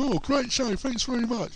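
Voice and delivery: high, gushing listener voice; lightly mimicked fan praise; mock fans. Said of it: "we got people".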